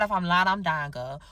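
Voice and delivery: usual speaking voice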